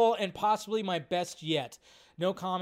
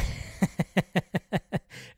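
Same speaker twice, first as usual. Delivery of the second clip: breathy burst of laughter; 3 semitones higher, 5 dB louder than usual. Real, not acted. Laughing at a chat joke.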